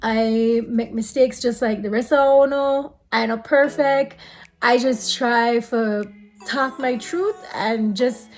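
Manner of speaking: ordinary talk, nothing marked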